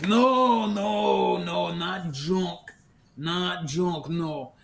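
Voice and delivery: loud, drawn-out alien junk dealer; Volto. Acted. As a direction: protest in an exaggerated character voice. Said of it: "doing one of his voices again".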